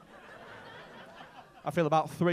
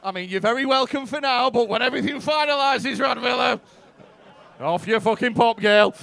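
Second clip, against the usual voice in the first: loud, raised mock tirade; mock angry rant; 4 semitones higher, 13 dB louder than usual. Comic jokingly telling Lithuanian audience member to leave, loud mock rant. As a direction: shout it as a joke bigot.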